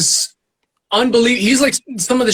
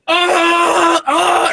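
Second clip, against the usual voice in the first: much higher shouted crowd groan; 12 semitones higher than usual. Retold or imitated. Crowd reacting to the mic drop, high shouted groan. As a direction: cry out like a stunned audience. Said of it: "everybody's like".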